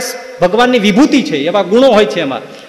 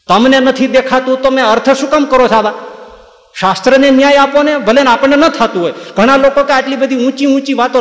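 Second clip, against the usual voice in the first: heated; 7 semitones higher than usual. Real, not acted. Preacher scolding listeners.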